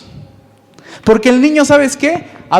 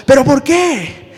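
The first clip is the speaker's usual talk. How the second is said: higher, slow, defiant mock shout; girl raising voice; 6 semitones higher, slower than usual. Daughter shouting back at her mom, mock defiant. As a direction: talk back like a stubborn girl. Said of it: "la niña levanta un volumen la voz".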